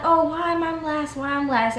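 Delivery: mock whining, high-pitched; whiny big baby. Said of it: "a big baby about it".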